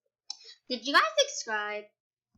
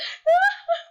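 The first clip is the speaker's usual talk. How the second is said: high loud laugh; 9 dB louder than usual. Real, not acted. Laughing at herself crying in the game, loud and high.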